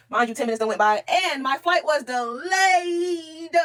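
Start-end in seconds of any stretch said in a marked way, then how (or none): 1.1-3.5 high, slow sobbing wail. Retold or imitated. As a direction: stretch it out like a wail. Wailing over the missed flight.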